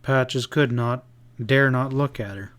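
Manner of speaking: usual speaking voice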